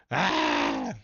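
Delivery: loud wordless yell of frustration; sudden shout. Real, not acted. Streamer fed up with losing stocks, frustrated shout. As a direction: let out one loud "Ah!". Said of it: "Sorry for the shout".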